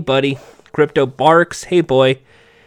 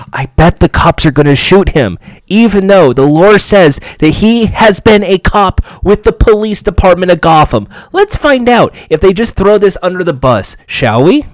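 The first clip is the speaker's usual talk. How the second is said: loud, raised, emphatic commentary; worked-up aside; 4 semitones higher, 7 dB louder than usual. Real, not acted. Viewer betting the cops will shoot Jack Drake, loud worked-up aside. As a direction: belt out the cynical prediction.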